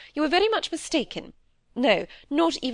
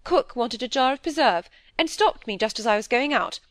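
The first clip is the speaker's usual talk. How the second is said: higher, defensive self-justifying flow; plucking up courage; 6 semitones higher, 4 dB louder than usual. A narrator's reading. Wife defends her eavesdropping to husband, plucking up courage. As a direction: face up and justify yourself, pitched higher. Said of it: "gave her courage, she turned and faced him".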